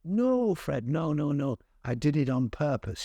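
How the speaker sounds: put-on playful Wiltshire accent, unrepentant. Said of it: "He spoke in a sort of Wiltshire accent".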